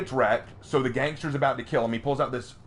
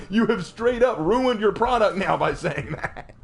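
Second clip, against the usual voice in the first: normal talking voice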